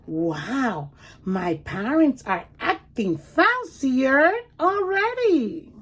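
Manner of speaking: lively storybook read-aloud; reading to children. Acted. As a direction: read it like a picture book for small kids. Reads picture book aloud.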